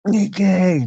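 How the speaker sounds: calls the name out high and loud; a big happy hello to a viewer; excited greeting. Real, not acted. Greeting a viewer joining his live stream, excited call-out.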